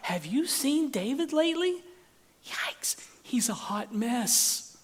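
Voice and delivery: high-pitched, mock-concerned gossip; gossiping friends. David's close friends talking behind his back, gossipy. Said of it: "close friends, are like".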